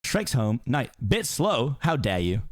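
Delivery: normal talking voice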